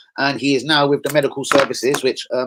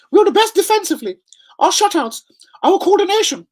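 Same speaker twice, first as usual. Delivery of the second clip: much higher, squeaky mock voice of a ranting manager; mocking the Southampton manager; 5 dB louder than usual. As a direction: lampoon a boastful manager who has lost it, pitched way up. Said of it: "He was with Luton".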